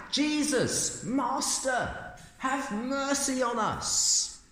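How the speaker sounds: high, drawn-out cry for mercy; pleading shout. Acted. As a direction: call out from a distance, begging, high and slow. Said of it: "they're shouting out".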